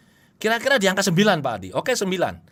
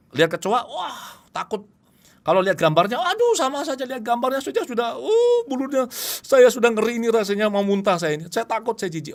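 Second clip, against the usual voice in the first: higher, shuddering client voice, acting scared and disgusted; acted phobic client; 6 semitones higher than usual. Client tells hypnotherapist of cockroach fear, higher disgusted fearful voice. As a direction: play the client cringing at a cockroach.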